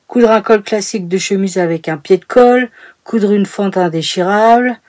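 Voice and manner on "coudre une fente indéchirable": normal talking voice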